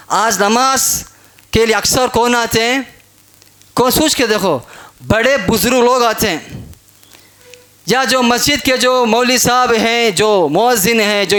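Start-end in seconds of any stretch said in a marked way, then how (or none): none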